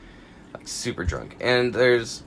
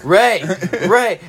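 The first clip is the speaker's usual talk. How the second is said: higher, louder call of his name; 6 semitones higher, 7 dB louder than usual. Retold or imitated. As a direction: shout for him one last time. Still calling drunk friend.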